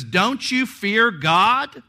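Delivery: loud, high, slow rebuke of the other criminal; rebuking shout. Retold or imitated. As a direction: hurl the question at him. Criminal's rebuke, read aloud.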